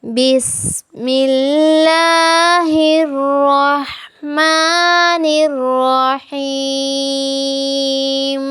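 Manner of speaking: slow, melodic tajweed recitation; Quran recitation. Shown as a demonstration. Teacher recites for her students with slow melodic tajweed.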